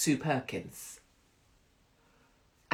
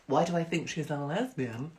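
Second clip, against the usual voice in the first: usual speaking voice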